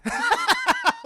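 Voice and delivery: real loud squealing giggle; high laugh. Real, not acted. Reviewer delighted by Peter's entrance, loud high laugh.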